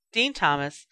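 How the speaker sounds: ordinary delivery, nothing marked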